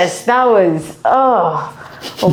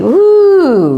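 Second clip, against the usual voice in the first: relieved whoop; 9 semitones higher, 4 dB louder than usual. Real, not acted. Whoop of relief after crack.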